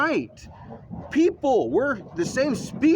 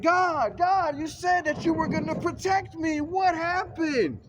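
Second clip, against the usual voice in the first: screaming for God; 8 semitones higher than usual. Retold or imitated. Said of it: "screaming for God".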